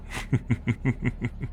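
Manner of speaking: soft, knowing chuckle. Acted. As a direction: chuckle quietly at the other's denial. Amused at the denial.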